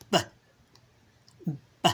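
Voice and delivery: B as a short buh, not the letter name; isolated b sound. Shown as a demonstration. Playing I Spy with a child, isolated sound. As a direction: say "B" as one quick buh, paused between. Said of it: "the sound you're saying".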